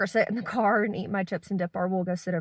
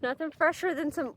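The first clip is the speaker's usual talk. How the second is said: ordinary delivery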